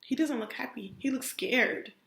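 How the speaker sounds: ordinary delivery, nothing marked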